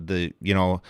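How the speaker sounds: ordinary talk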